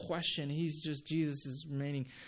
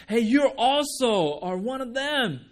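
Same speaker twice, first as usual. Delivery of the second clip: higher, loud calling out; loud accusation; 7 semitones higher, 10 dB louder than usual. Acted. Bystander accusing Peter in the courtyard, loud and higher. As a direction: call him out across the fire. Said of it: "someone else saw him and said".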